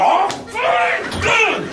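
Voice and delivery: high-pitched furious shouting; angry shout. Acted. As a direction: explode at the machine. Shouting back at the lift.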